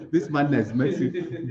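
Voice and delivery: usual speaking voice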